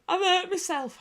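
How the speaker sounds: whiny hurt husband. Retold or imitated. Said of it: "And he went".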